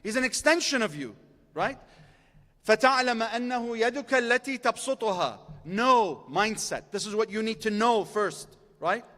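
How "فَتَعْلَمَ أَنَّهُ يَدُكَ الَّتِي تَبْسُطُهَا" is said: rapid recited Arabic quote; quick Arabic recitation. Acted. Preacher quoting the Imam's Arabic to the crowd, rapidly recited. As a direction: rattle off the source text.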